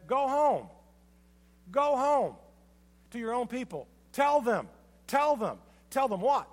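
loud sermon call of go home and tell them; preached command. Acted. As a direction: drive home each charge with full preaching force. Preacher voices Jesus' command.

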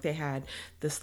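usual speaking voice

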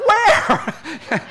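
loud, high, alarmed yell; startled dad's shout. Retold or imitated. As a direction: blurt it out in panic at the wheel. Startled dad shouts back.

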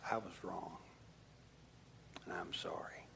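soft, whispered I was wrong and I'm sorry; whispered apology. Shown as a demonstration. Pastor whispering the words kids need to hear. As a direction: breathe it like a quiet confession. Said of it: "our kids need to hear us say".

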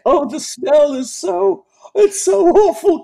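very high falsetto, slow mock disgust; mock Troi. Mocking Troi complaining to the captain, very high falsetto. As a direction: whine to the captain like the counselor.

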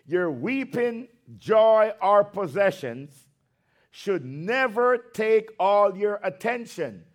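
slowed-down emphatic dictation. Shown as a demonstration. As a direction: spell it out slowly so they can write. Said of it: "for those with the slow pen".

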